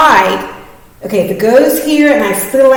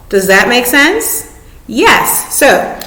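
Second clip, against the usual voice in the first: normal talking voice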